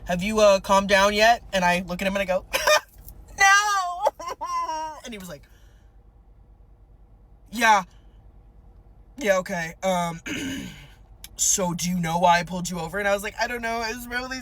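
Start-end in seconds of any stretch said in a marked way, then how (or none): 0.1-1.4 low throat-clearing patrol officer voice; cop impression. Shown as a demonstration. Officer returns with license, cop impression. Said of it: "my cop impression". 2.5-4.5 mock sobbing. Driver asked if she calmed down, mock wailing. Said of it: "I'm just sobbing". 7.5-13.0 lightly voiced hesitant patrol officer; cop impression. Officer asks wailing driver about stop, light cop impression. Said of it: "my cop impression".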